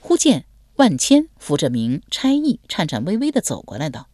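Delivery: plain reading voice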